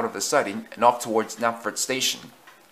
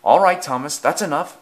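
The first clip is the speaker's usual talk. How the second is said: loud, higher cut-in; firm interruption; 4 semitones higher, 8 dB louder than usual. A narrator's reading. Station master stopping the quarrel, firm interruption. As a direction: break up the row, loud and firm. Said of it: "interrupted the station master".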